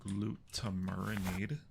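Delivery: ordinary delivery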